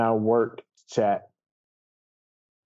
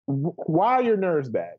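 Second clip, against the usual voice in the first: normal talking voice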